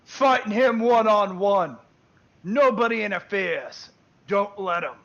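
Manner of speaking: high, loud battle bellow, easing slightly; screamed orc order. Acted. Orc chief orders his orcs not to interfere, screamed out. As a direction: scream orders out to a whole warband. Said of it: "You hear him scream out".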